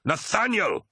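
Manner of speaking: very high, exasperated yell; frustrated scream. A narrator's reading. Tarvitz to his doubting honor brother, screaming in frustration. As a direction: cry the name out, pitched right up. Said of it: "screamed Tarvitz in frustration".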